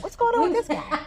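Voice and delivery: high, puzzled mock dog voice; voicing the dogs. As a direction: play the baffled dog. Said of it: "Even the dogs are walking around like".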